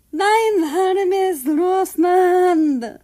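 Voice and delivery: very high, loud, drawn-out wail; dramatic cry. Acted. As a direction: wail it like a heartbroken parent, slow and loud. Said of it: "Nazi cried".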